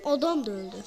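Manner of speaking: usual speaking voice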